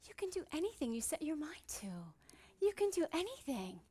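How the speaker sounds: a hint of a gentle mother, hushed whisper; loving mom. Mom lovingly encouraging her daughter, in a quick hushed whisper. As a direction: softly reassure a stubborn little girl. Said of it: "looked at me so lovingly and said".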